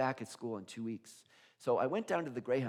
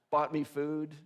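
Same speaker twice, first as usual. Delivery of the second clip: ordinary delivery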